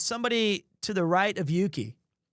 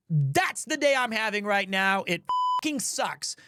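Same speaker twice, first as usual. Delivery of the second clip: ordinary talk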